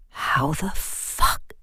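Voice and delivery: slow, breathless whisper of shock; a stunned, unvoiced whisper. A narrator's reading. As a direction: barely breathe it out, frozen. Frozen in fear, sees stones.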